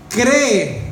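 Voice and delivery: loud, high single word Cree; firm command. Acted. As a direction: proclaim it like an order. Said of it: "ellos dijeron".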